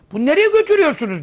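loud, fast, high cry of alarm; dead man crying out. Acted. Dead man in the coffin shouting at his bearers, high and fast.

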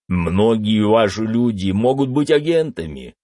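puffed-up, blustering politician; pompous senator. A narrator's reading. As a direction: lecture like a self-important senator. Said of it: "надув щёки продолжал вещать сенатор".

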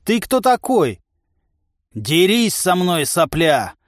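slow, drawn-out fighting cry; brawling challenger. A narrator's reading. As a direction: taunt a rival into a fight. Giant challenges men to fight.

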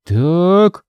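slow drawn-out so. Acted. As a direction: stretch the word out. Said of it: "протянул Митя".

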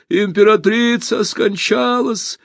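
very high, slow cry of announcement; grieving outcry. A narrator's reading. Voice telling the hall of the empress's death, high outcry. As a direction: cry out the terrible news, drawn out.

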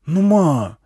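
put-on high, loud kid's whine to mum; young boy. A narrator's reading. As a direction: pester mum like a kid. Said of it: "просунулся между спинками сидений пацан".

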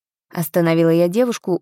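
plain reading voice

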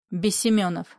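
ordinary delivery

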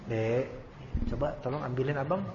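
soft, low husband's request; imitated gentle request. Husband asking his wife to fetch something, gently imitated. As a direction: ask a small favour quietly, as a husband.

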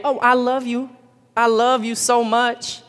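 high, slow crooning lover imitation; mock lover. Preacher mimics a partner faking love to the youth. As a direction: coo it like a smooth-talking fake lover. Mocking a smooth-talking lover.

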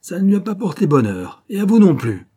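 high, loud jeer; gloating menace. Acted. Lieutenant gloating over the mezuzah, jeering threat.

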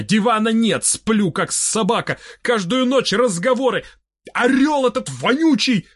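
high, rising outburst of grievances; indignant complaint. A narrator's reading. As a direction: pile up complaints, pitch climbing. Narrator's hero breaks in, complaining. (возмущённые жалобы высоким голосом)

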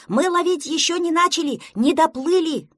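high, loud protest; shouting back. A narrator's reading. Duckling corrects his fishing partner, shouting. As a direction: yell the objection, pitched high. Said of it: "закричал в ответ утёнок".

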